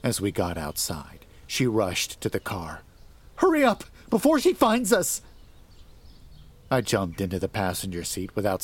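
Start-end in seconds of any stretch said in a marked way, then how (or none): 3.3-5.2 high, loud panicked cry; terrified wife fleeing to the car. A narrator's reading. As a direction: urge him on in terror.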